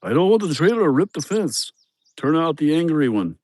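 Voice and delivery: put-on quoting the worker's worry about the fence in a Mexican accent. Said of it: "one of those Mexican boys".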